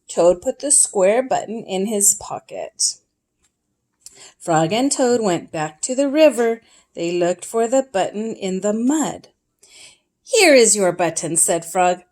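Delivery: gentle read-aloud storyteller voice; storybook reading. A narrator's reading. Parent reading Frog and Toad to a child, storytelling. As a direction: read it like a bedtime picture book.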